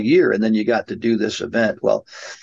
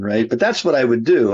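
ordinary delivery

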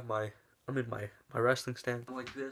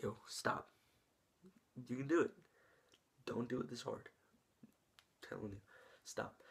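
put-on quiet firm warning to the kid; soft-spoken threat between wrestlers; hushed warning; 7 dB quieter than usual.

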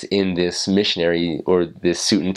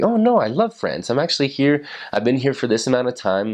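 high, gushing reassurance; eager protest; 6 semitones higher than usual. Retold or imitated. Missionary assuring a doubtful French local, gushing. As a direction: rush to show you love the place. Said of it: "that's when you're just like".

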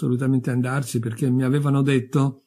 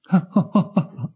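short staccato chuckle, pitched up; mocking laugh; 6 semitones higher, 3 dB louder than usual. Dead man laughs at the lady's outburst, mocking. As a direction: laugh off the accusation.

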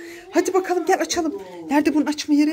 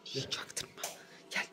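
whisper; 9 semitones lower, 23 dB quieter than usual. Real, not acted. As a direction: whisper it so dad won't hear. Mother plots secretly with daughter. (fısıldayarak, gizlice)

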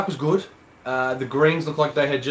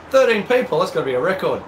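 excited; 6 semitones higher, 4 dB louder than usual. Real, not acted. Surprise at many live viewers.

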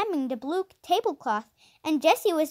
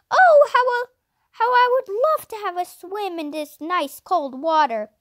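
loud high longing sigh of oh; 4 semitones higher, 5 dB louder than usual. A narrator's reading. Hot sticky boy looking at the brook, longing cry. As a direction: yearn for the cold water. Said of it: "Henry was hot and sticky".

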